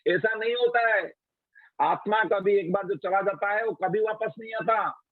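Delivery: high scolding reproach; scolding queens. Retold or imitated. Queens scolding the naive boy, high reproachful voice. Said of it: "तो रानियां बोलती हैं".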